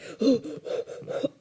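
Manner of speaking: sharp frightened gasp; a sudden startled gasp; scared gasp. Retold or imitated. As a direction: gasp in fright as if seeing something. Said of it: "and I'm just like".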